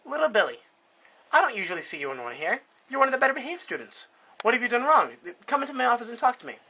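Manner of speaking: a mildly put-on concerned principal; high-ish brisk school principal. Principal voiced in a joke story.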